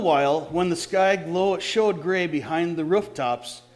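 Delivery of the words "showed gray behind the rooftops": plain reading voice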